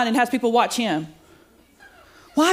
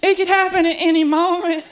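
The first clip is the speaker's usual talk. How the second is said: higher, a hint of frightened daughter; panicked daughter; 7 semitones higher than usual. Daughter in the ocean, a touch of panic. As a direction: blurt it out in sudden dread. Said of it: "She's shaking and crying".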